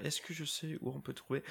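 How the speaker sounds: quick, soft thinking aloud in French; hushed French self-questioning, imitated; imitated puzzled musing. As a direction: mutter it quickly to yourself, searching. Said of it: "She was going through in her head".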